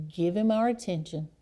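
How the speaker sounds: ordinary talk, nothing marked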